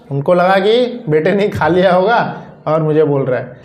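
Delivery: ordinary delivery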